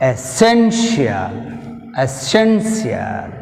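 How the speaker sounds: Essential, slow, stress on sen; slow Essential, hit sen; stress demo. Shown as a demonstration. (సెన్ మీద ఒత్తిడి)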